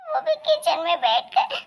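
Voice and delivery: high, fumbling, giggly excuses; a flustered bride laughing nervously through her excuses; nervous young daughter-in-law. Acted. Caught daughter-in-law stammering excuses to her in-laws, high and nervous.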